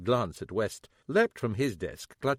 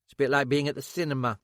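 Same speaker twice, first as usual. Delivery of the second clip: normal talking voice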